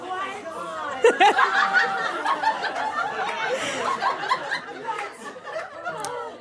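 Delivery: long high-pitched helpless laughter; shrieking laugh that keeps going. Real, not acted. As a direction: crack up at the memory. Laughing at the absurd threat.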